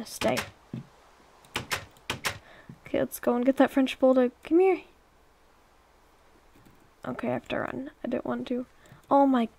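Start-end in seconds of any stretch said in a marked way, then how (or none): 4.4-4.8 higher, louder call to a pet; raised, coaxing call; calling a dog. Real, not acted. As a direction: call the runaway dog over. Calling a runaway game dog.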